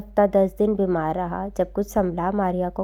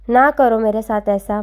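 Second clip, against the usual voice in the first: loud desperate plea; a lover begging on the phone, loud and desperate; pleading; 3 semitones higher, 8 dB louder than usual. Acted. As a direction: beg her loudly, voice raised. Hammad begs Maria on phone.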